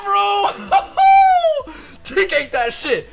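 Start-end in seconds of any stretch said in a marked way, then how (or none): 0.6-2.0 high excited cackle; shrieking with laughter. Real, not acted. Celebrating a drop.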